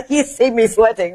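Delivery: ordinary delivery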